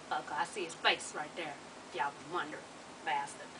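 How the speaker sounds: low, quiet grumbled curse; muttering. Real, not acted. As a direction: curse him under your breath.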